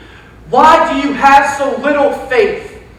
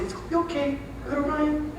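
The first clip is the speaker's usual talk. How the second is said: mock soothing; 5 semitones higher, 13 dB quieter than usual. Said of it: "tap your bottom and say".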